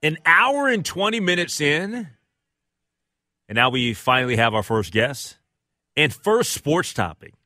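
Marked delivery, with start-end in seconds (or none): none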